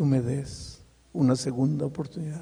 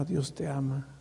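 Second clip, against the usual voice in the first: Dios te ama almost gone, much softer; fading to a hush; 9 dB quieter than usual. Shown as a demonstration. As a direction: breathe Dios te ama out as a fading echo in the ear. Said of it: "Y aquí en mi oído".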